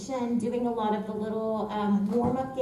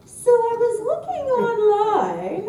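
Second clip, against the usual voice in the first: higher, louder, drawn-out quote; retold talent agent; 10 semitones higher, 8 dB louder, slower than usual. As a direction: play the agent, slow and high. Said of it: "my talent agent was like".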